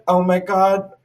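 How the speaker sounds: high-pitched mock alarm; retold panic. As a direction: imitate your own inner panic. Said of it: "I'm, like, panicking".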